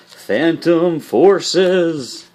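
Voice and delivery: slow, drawn-out mock-spooky voice; playful haunted-house delivery, very slow. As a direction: stretch it out like a pretend ghost. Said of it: "say anything in a voice like that".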